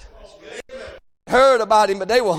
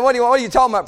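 ordinary talk

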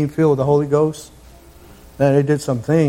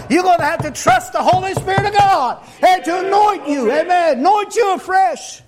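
high, strained pulpit delivery; full-force preaching; 13 semitones higher than usual. Real, not acted. Preacher urging his congregation, high-pitched full-force preaching. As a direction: preach it in a high, driving cry.

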